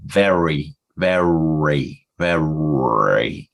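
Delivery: "very" slow, each R rolled out with care; slow careful R. Shown as a demonstration. Learner showing his slow native-sounding R. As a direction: stretch "very" so the R comes through clearly. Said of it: "when I do it slowly".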